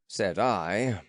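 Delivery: ordinary delivery, nothing marked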